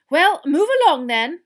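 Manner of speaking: loud, high, brisk-tempered command; sharp order. Acted. Teacher to the bedraggled crowd, sharply. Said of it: "said Professor McGonagall sharply".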